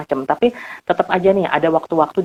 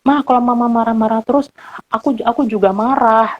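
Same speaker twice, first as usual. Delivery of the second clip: higher, mildly sulky tone; mock upset child; 6 semitones higher than usual. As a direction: tell mom you're angry too, a bit whiny. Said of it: "boleh juga misalnya bilang". (anak sedikit merajuk kepada mama)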